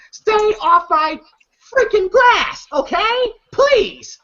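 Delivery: high-pitched mock exasperation; irritated warning. Neighbour warning fellas off the lawn, high exasperated plea. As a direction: play the fed-up neighbour, voice jumping high.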